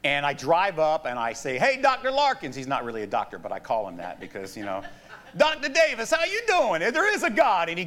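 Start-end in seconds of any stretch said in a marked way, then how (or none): 1.6-2.5 higher-pitched friendly hail; calling out a greeting. Retold or imitated. Preacher retells hailing a friend as he drives up, calling out. 5.4-7.7 high, hearty hail, acted out; exuberant greeting. Friend greets the preacher on seeing him, exuberant.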